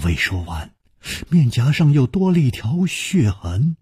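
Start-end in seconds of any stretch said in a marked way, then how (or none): none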